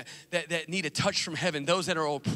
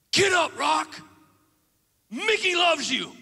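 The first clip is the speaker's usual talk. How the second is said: high, loud, slow rousing voice; rally him from the corner; old trainer's call; 8 semitones higher, 5 dB louder, slower than usual. Acted. Trainer Mickey in a flashback, loud rousing call. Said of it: "He's like".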